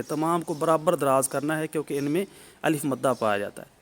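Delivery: normal talking voice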